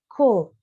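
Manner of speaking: cold said loudly as a model for the class; loud model word cold; repeat after me. Shown as a demonstration. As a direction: give cold for the class to copy. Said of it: "Coba diulangi".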